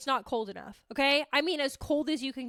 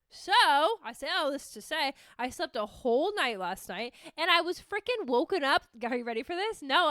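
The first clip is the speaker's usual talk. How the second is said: ordinary talk, nothing marked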